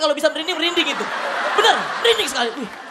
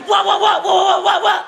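higher, louder mechanical wah shouts; piano-key crowd shout; 6 semitones higher, 4 dB louder than usual. Shown as a demonstration. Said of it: "Ada dungnya lagi".

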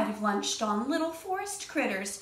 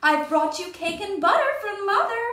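mock girl voice; 8 semitones higher, 4 dB louder than usual. Wolf imitating Little Red.

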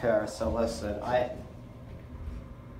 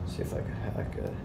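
quick conspiratorial whisper; 9 dB quieter, faster than usual. Real, not acted. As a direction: mutter it fast under your breath like you're up to mischief. Sneaky aside at elevator phone.

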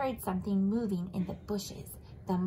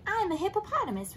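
hippo voice; 9 semitones higher, faster than usual. Acted. Hippo answers pelican.

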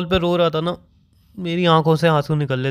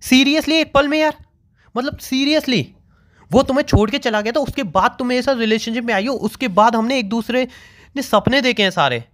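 angry; 7 semitones higher than usual. Real, not acted. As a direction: rant in rising anger. Boyfriend furious at breakup news.

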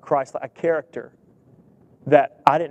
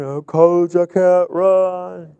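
slow, whining "I can't run"; whiny lineman; 4 dB louder, much slower than usual. Retold or imitated.

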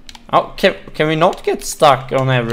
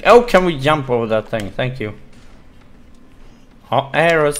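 ordinary delivery

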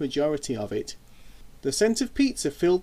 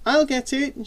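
put-on cheerful girl; 11 semitones higher, 3 dB louder than usual. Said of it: "she said".

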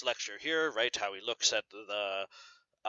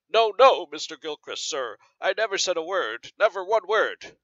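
higher and louder, a servant desperately pleading innocence; panicked cry; 5 semitones higher, 6 dB louder than usual. Acted. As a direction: cry out in alarm, begging to be believed. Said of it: "cried the servant".

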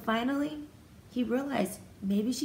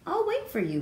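calling out; 11 semitones higher than usual. Acted. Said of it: "Marcel called".

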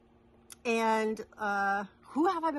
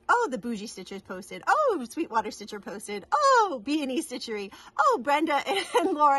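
rising excitement with each name, getting higher and louder; each "oh" bigger than the last; mock alert excitement; 4 semitones higher, 5 dB louder than usual. Vlogger acting out pinging phone alerts, mounting mock excitement.

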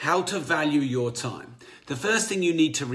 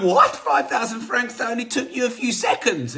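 much higher and louder, incredulous outburst; shocked; 9 semitones higher, 5 dB louder than usual. Retold or imitated. Shocked lady balks at Picasso's price, high and loud. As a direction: gasp out the price in disbelief.

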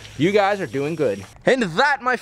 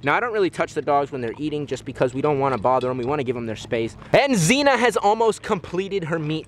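ordinary delivery

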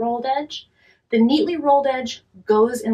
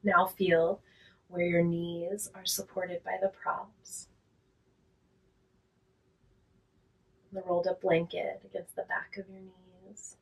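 guided relaxation; 5 dB quieter than usual. Real, not acted. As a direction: talk them gently down into rest, unhurried and quiet.

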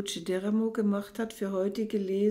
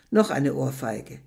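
normal talking voice

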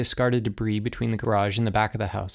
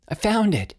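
much higher, calling out to friends; high, thin call; 11 semitones higher than usual. A narrator's reading. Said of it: "called in a high, thin voice".

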